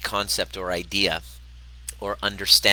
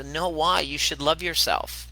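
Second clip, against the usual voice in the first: higher-pitched, pleading parental protest; 8 semitones higher than usual. Retold or imitated. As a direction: imitate a parent objecting to a child's self-criticism. Parent protests to self-hating daughter.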